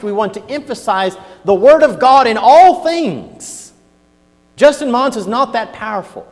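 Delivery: full-voiced pulpit warning; fiery preaching. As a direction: preach it up to a strong finish.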